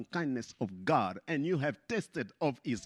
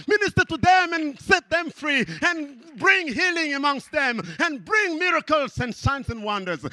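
high, loud commanding call to angels; voicing God; 10 semitones higher, 6 dB louder than usual. God calling angels to join the praise, loud. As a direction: summon the angels to act. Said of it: "God says".

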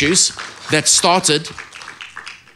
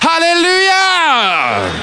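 long shouted hallelujah, much higher and slow; loud hallelujah; 4 dB louder, much slower than usual. Shown as a demonstration. Preacher leading the church, drawn-out shouted hallelujah. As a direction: belt it out to lift the room. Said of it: "I want you to say it louder".

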